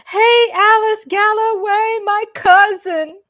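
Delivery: excited shout-out. Real, not acted. Shout-out to cousin on live.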